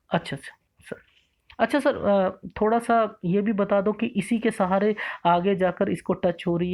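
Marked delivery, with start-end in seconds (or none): 0.1-0.9 soft breathy whisper of agreement; barely voiced, just to yourself; student quietly whispering okay sir. Real, not acted.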